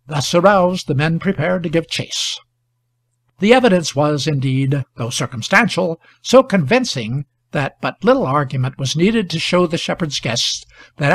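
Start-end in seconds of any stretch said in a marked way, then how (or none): none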